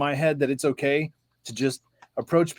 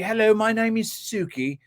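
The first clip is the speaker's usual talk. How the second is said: higher-pitched imitated English accent; Suki; 7 semitones higher than usual. Friend introducing herself, in a high English accent. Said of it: "she's from England".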